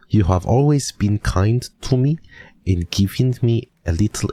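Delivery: playful mock German letter-reading voice; German accent. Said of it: "What is this accent?".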